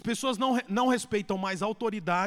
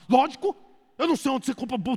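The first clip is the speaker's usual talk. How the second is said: louder, fast, snappish; irritated retort; 4 dB louder, faster than usual. Retold or imitated. Husband snaps at wife over her handbags, irritated. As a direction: snap back at the wife. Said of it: "Como é que o marido fala?".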